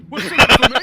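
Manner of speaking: loud demand. Acted. Haggling over building beds.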